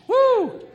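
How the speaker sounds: high, loud "woo"; spontaneous whoop. Real, not acted. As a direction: let out a high preacher's whoop. Preacher whoops after call to action.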